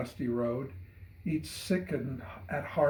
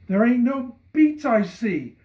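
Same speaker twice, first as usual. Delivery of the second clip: put-on much higher, loud, alarmed child's cry; distraught cry; 12 semitones higher, 10 dB louder than usual. Boy crying out in alarm on his knees in the field. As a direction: cry it out in dismay on your knees. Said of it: "he cried upon his knees".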